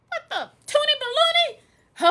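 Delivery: very high-pitched mock bafflement; acted disbelief. Speaker replaying shock at the movie wedding, high mock disbelief. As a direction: squeak out your shocked confusion. Reacting to surprise same-sex wedding.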